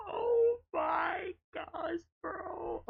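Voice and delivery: high, slow wheezing laugh; crying laughing. Real, not acted. Streamer cracks up at himself, crying with laughter. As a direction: lose it completely.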